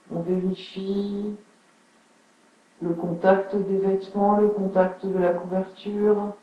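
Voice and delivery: unhurried yoga nidra guidance with long pauses; guided relaxation. Acted. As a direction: settle listeners into stillness, phrase by phrase. (voix posée de relaxation guidée)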